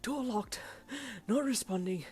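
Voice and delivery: breathless, broken phrases; winded, wheezy urgency; wheezing. A narrator's reading. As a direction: gasp out the report between breaths. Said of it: "he wheezed".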